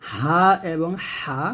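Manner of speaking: হা এবং হা drawn out very slowly, each হা distinct; slow teacher demo of two হা letters; two ha sounds. Shown as a demonstration. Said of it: "তারপর হচ্ছে".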